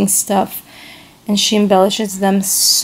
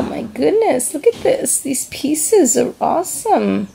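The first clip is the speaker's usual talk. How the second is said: higher, thrilled look at this; excited delight; 6 semitones higher than usual. Real, not acted. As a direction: squeal with delight over the gift. Excited over gifted bracelet pieces.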